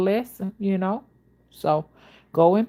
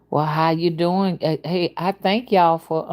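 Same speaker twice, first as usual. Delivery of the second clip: usual speaking voice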